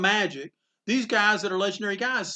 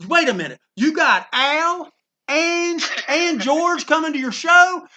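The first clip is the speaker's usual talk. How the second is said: loud, high, drawn-out mock astonishment; amazed disbelief; 8 semitones higher, 8 dB louder, slower than usual. Artist hearing who is coming to the show, acted astonished. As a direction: act out a guest stunned by the lineup. Retold amazed reaction to guest list.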